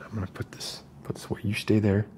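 usual speaking voice

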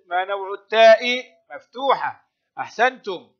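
normal talking voice

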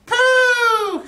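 put-on very high, loud baby echo. A narrator's reading. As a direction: copy it like a toddler. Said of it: "says the baby".

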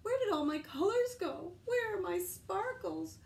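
high, loud distressed plea; upset. Acted. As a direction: cry out for lost sparkles. Unicorn upset over lost colors.

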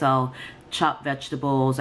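ordinary talk

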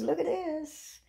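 high-pitched proud boasting; proud showing off. Retold or imitated. Showing neighbors her diploma, proud and high-pitched. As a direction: show it off to everyone, beaming. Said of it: "showed it to all my neighbors".

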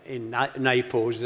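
usual speaking voice

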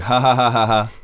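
flat, mocking ha-ha-ha; sarcastic fake laugh. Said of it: "it sounds sarcastic".

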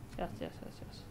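quick soft whispering to herself; whispered mutter. Real, not acted. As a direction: murmur it under your breath. Mutters while turning page.

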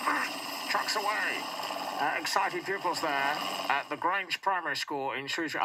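muffled fake helicopter radio; talking into hand. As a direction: speak into your cupped hand like a radio. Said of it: "I'm just talking into my hand like that".

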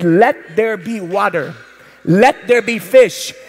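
slow and solemn; slow creation decree. Acted. Preacher quoting God's creation words, slow. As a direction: draw out each word like a decree. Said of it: "it said".

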